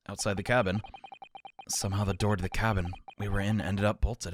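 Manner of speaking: low, measured narrator; story narration. A narrator's reading. Reads the game's narration.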